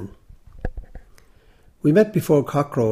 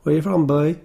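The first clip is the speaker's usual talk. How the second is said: lightly voiced Cork accent, raised and louder; benevolent Cork accent; 4 semitones higher, 6 dB louder than usual. A narrator's reading. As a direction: kindly question with a touch of Cork. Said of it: "a benevolent Cork accent".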